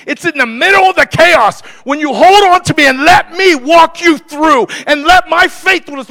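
high, shouted, driving exhortation; full-force preaching. Real, not acted. Preacher urging trust in the valley and chaos, high and impassioned. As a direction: preach trust with long, punched phrases.

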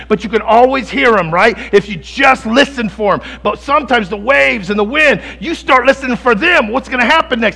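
loud, driving sermon build; impassioned preacher pressing the point; preaching at full force. Acted. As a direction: preach it hard to the congregation.

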